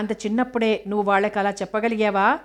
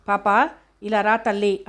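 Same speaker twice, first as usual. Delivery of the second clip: lightly voiced old woman, slow and sweet-talking; a hint of an old crone coaxing the child, drawn out; old woman calling. Old woman calls the girl. (ముసలావిడ నెమ్మదిగా పాపను బుజ్జగిస్తూ పిలవడం)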